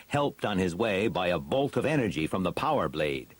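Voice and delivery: ordinary delivery, nothing marked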